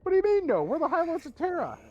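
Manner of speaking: high, loud outraged whine; indignant High Lord. Retold or imitated. High Lord outraged at Gilliman's refusal, high loud indignation. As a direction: squeal with offended importance. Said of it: "picturing Gilliman going".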